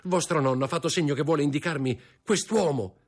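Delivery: high, agitated plea for help; urgent pleading. A narrator's reading. Young man begs his fiancée to help, urgent plea. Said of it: "gridò Franz".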